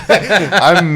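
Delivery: slow, laughing words. Real, not acted. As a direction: laugh through the line. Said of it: "laughing through his joke".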